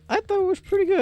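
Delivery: high-pitched mock friend voice; squeaky put-on retort; imitating the friend. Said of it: "he was like".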